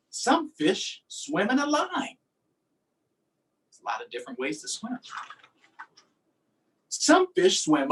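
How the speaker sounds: lively, sing-song picture-book reading; reading to children. Acted. Teacher reading a fish book to her class, lively storytime voice. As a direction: read it out like storytime for little kids.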